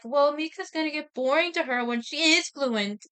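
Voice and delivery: acted-out comeback with emphatic rise; mock objection. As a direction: argue back in a playful mock protest. Acted retort of story character.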